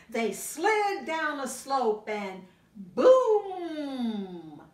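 slow build, loud boom; slow down then burst on boom; dramatic build to boom. A narrator's reading. Reading to a child, slow then loud boom.

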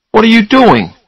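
alarmed. A narrator's reading. Charlie sees Susanna packing her suitcase, alarmed. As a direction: demand to know, pitch leaping up.

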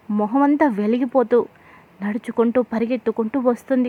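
usual speaking voice

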